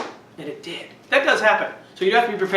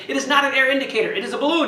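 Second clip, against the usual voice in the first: mock-indignant kids, higher, louder and fast; kids arguing back; 6 semitones higher, 3 dB louder, faster than usual. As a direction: play the know-it-all campers objecting. Campers argue with the teacher.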